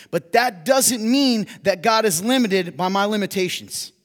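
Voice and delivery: loud, high, driving sermon voice; preaching at full force. Real, not acted. Pastor admitting his limits to the congregation, preaching loud. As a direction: preach it hard to the room.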